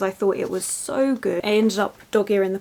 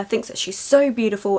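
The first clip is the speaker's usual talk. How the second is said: ordinary talk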